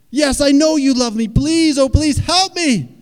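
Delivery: high screamed plea, stretched out at the end; frantic begging. Acted. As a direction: beg at full volume, drawing out the final cry. Man begs more desperately.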